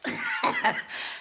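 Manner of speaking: breathy laugh at own joke; amused laugh. Real, not acted. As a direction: laugh it off playfully.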